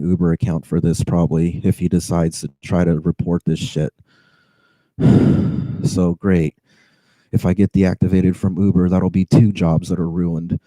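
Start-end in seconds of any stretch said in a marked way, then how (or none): 5.0-5.8 short heavy exhale; real exasperated sigh. Real, not acted. Driver fearing deactivation from Uber, real exasperated sigh. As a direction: sigh hard in frustration.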